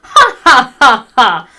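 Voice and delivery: loud hammed-up ha ha ha; mocking laughter. Dumbledore scoffs at Ebony, loud mocking laugh. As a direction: cackle at her like a smug headmaster.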